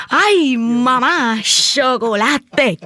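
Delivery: slow, drawn-out delighted cry; excited discovery. Retold or imitated. Girl in Cuba finding chocolate, slow delighted cry. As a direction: stretch out the joy of finding chocolate.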